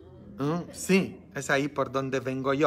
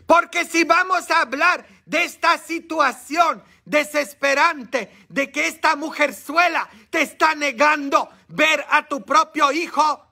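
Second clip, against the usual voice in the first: shouting high and loud from the pulpit; full-force preacher's shout; preaching shout; 12 semitones higher, 5 dB louder than usual. Real, not acted. Preacher thunders about the situation.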